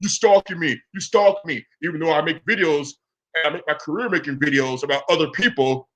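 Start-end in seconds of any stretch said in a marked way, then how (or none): 0.0-1.7 mock whine, pitched higher; Tommy. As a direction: imitate a complainer with scornful repetition. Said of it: "He says that stupid shit".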